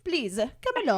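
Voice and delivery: put-on loud French-accented tour guide. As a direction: usher them on in a mock French accent. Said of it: "do it with a French accent".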